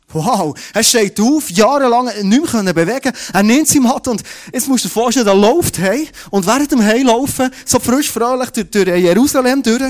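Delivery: loud, high, marvelling retelling; excited amazement. Real, not acted. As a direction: exclaim the miracle loudly and high. Preacher marvels at the healing. (laut und begeistert staunend)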